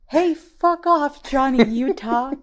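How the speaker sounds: high, loud, slow yelled quote; impression. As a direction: stretch out the yell high and loud. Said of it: "I'm gonna".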